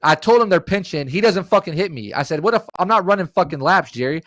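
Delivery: high-pitched, exasperated retort; indignant protest. Retold or imitated. Acted protest in retold argument.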